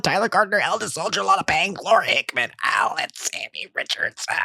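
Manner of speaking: rushing names on one breath; running out of air at the end. Real, not acted. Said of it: "I'm going to do it in one breath".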